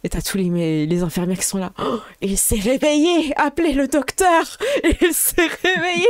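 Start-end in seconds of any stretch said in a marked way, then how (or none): 1.8-4.6 excited nurses calling. Acted. 4.8-6.1 dramatic exclamation. Acted. Dramatic awakening announcement.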